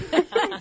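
quick high laugh; a short amused laugh. Real, not acted. Laughs at his own joke.

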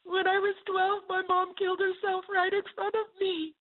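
put-on high, small, childlike confession; tiny little girl voice. Angry cashier suddenly confessing to the speaker, tiny little girl voice. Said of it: "tiny little girl voice".

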